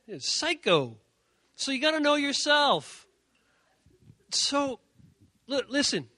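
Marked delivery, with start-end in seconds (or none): none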